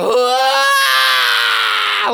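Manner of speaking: sharp high yelp of pain; a kid's startled cry at a splinter. Retold or imitated. Kid grabbing banister, yelping high and loud. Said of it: "I was like".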